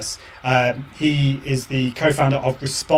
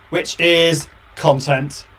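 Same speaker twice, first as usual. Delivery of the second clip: usual speaking voice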